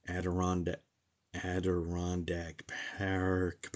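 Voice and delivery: slow, drawn-out syllables on "Adirondack Park"; careful sounding-out. Real, not acted. Streamer proving he can read a tricky name, careful sounding-out. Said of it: "I'm gonna say it".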